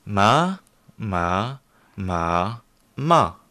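learner repeats "Ma, ma, ma, ma" with the four tones: level, rising, dipping, falling; careful learner's imitation of each tone on "ma"; student echoing the four tones of "ma"; repeating the tones. Shown as a demonstration. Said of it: "C'est bon?".

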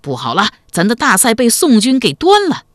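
high, loud cry of bad news; shouted alarm. A narrator's reading. Soldiers at the rear crying that camp is lost, high alarmed shout. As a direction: sound the alarm to the troops. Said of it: "突然有人高声喊道".

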